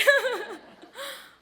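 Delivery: high, loud laugh through tears; real overwhelmed giggle; teary laugh. Real, not acted. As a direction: laugh to keep from crying. Laughs while fighting tears.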